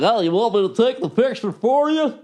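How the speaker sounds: high, goofy put-on helper voice; douche. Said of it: "some douche has to come along".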